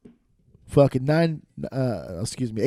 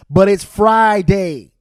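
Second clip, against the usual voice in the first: loud despairing emphasis; 3 semitones higher, 8 dB louder, slower than usual. Acted.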